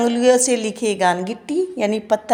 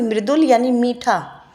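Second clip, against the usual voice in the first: normal talking voice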